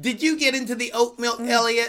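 high scolding mother voice; scolding mom. Pretend. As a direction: catch the child out. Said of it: "like some mom just caught their child".

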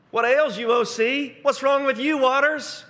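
high, loud, mocking jeer at the sea; taunting. Psalmist taunting the fleeing sea, high loud mockery. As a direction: tease the sea like a cocky winner. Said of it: "he starts taunting".

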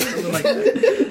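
ordinary delivery

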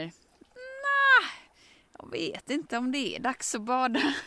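reluctant. Retold or imitated. Reluctant reply to bathing suggestion.

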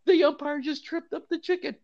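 high mock crowd scream; screaming fans. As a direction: yell it like a shocked fan in the stands. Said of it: "Fans are screaming".

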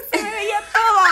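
high laughing cry of embarrassment; laughing exclamation. Real, not acted. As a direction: squeal it out, half laughing. Embarrassed punchline told twice.

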